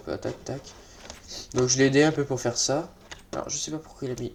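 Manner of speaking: ordinary delivery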